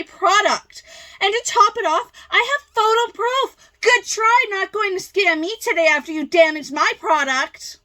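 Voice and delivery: high, loud indignant boasting; irate seller. Acted. Seller refusing to be scammed by the buyer, irate.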